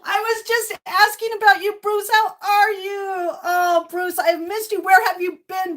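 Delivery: high, loud gushing welcome; excited greeting. Real, not acted. Streamer gushing to Bruce in chat, thrilled. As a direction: gush over him, thrilled.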